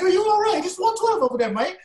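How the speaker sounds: high, loud, hammed-up British fan voice; the group member imitates a British fan spotting them; mock British accent. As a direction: play a starstruck British fan in a hammy accent. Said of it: "that's my British accent".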